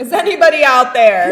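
calling out. Pretend. Mock calling out to listeners.

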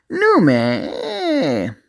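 high, drawn-out monster wail; slug creature's cry. Acted. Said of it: "the slug cried out".

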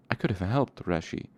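plain reading voice

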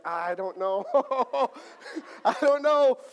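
embarrassed mock squeal, rising high; flustered reply breaking into a laugh. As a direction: act out a mortified, giggly girlfriend. Retold embarrassed girlfriend, flustered.

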